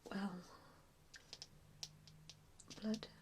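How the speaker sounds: ordinary talk, nothing marked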